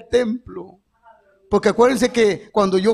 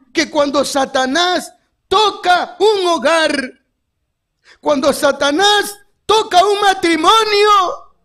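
slow, higher, emphatic preacher cadence; preaching at full force; 5 semitones higher, slower than usual. Acted. Preacher to the church about healed homes, full-force preaching. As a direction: drive each phrase home slowly.

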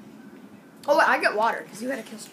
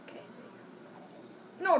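ordinary talk

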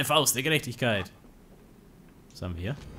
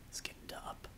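hushed sneaky whisper; 19 dB quieter than usual. Real, not acted.

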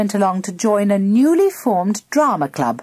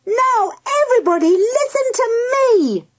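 put-on very high, fast girl's shout; high-pitched commanding call. A narrator's reading. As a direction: yell for everyone's attention in a high voice. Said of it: "shouted Mandy".

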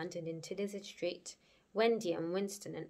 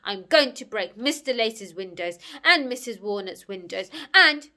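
loud, mounting angry threat; growled threat; 11 dB louder than usual. Acted. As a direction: growl the list louder and louder. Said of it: "growled Alvis".

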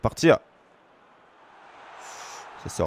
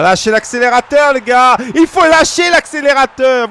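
shouting at players; 5 semitones higher, 5 dB louder than usual. Real, not acted.

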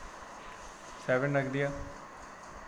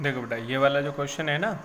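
ordinary talk

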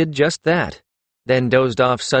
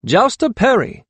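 raised, higher-pitched call; 10 semitones higher, 4 dB louder than usual. A narrator's reading. Karun rounding a corner to Perry, calling out. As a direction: hail someone approaching. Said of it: "he called".

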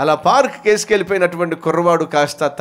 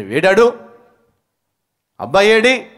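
angry demand; 6 semitones higher, 7 dB louder than usual. Acted. Father storms in looking for son.

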